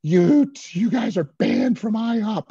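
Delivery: mimicked screaming manager ordering people out; high, loud, drawn-out imitation yell. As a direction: screech it like a furious manager. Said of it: "and she's screaming".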